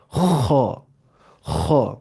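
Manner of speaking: "خو" as thick "khaw", scraped and rounded; heavy kha. Shown as a demonstration. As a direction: keep the mouth narrow so "خو" comes out thick. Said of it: "منہ کھولنا ہے کم".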